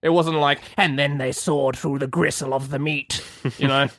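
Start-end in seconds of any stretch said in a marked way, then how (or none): 0.8-3.2 mock storybook narration. Mock dramatic narration of killing.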